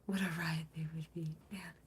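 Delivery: breathy whispered aside; dreamy hushed musing. Real, not acted. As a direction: whisper it to yourself, wistful.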